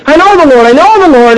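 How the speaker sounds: high, loud joyful cry of faith; excited new believer. Retold or imitated. New convert shouting his joy to the congregation, excited. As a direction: burst out with glee.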